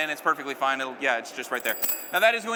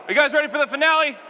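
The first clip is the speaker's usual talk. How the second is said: hype call; 6 semitones higher, 6 dB louder than usual. Shown as a demonstration. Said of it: "Let's try that again".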